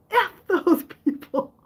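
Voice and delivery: high, loud laughing mom impression; mom's defiant line acted high through laughter. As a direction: crack up while doing mom. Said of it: "She said".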